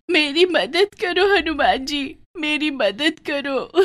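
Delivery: high, slow and tearful plea; weeping prayer. Acted. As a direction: beg Hanuman through sobs. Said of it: "जोर-जोर से रोने लगी".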